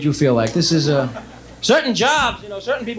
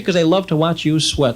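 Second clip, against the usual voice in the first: ordinary delivery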